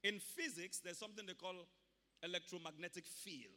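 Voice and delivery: ordinary delivery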